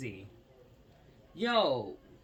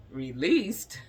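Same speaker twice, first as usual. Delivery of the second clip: louder, incredulous echo of the word; disbelief; 6 dB louder than usual. Real, not acted. Reading news aloud to viewers, incredulous. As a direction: repeat it like you can't believe it.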